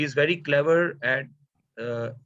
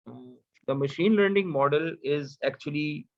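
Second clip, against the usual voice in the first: normal talking voice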